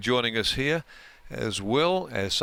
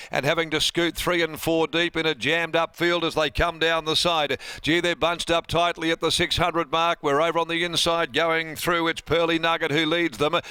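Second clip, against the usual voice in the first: higher, louder live race calling; 6 semitones higher, 3 dB louder than usual. Acted. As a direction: call the horses home, building excitement. Caller takes over the race.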